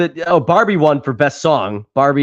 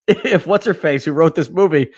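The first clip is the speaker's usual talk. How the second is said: ordinary talk, nothing marked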